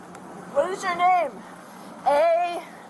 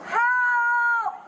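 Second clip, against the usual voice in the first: shouting for help; 6 semitones higher, much slower than usual. Real, not acted. Woman being arrested yells for help.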